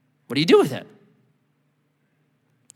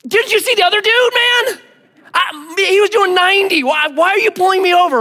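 high, loud, whining excuse; whiny protesting driver; 8 dB louder than usual. Retold or imitated. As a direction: plead your case to the cop, indignant. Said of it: "what do you say to him".

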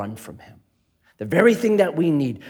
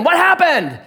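much higher, startled cry of a worried wife; mock alarm from the wife; 12 semitones higher, 4 dB louder than usual. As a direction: rush in asking in alarm.

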